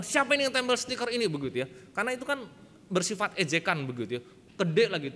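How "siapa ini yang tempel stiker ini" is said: high, loud, rapid retold demand; very annoyed. Boy finding the mocking sticker on his cupboard, annoyed. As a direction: act out an indignant outburst. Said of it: "saya baca itu kesel banget".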